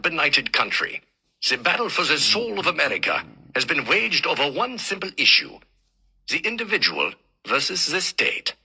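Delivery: a hint of a lecturing German professor, German accent; lightly voiced old German teacher in German accent; thick German accent. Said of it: "German accent so thick".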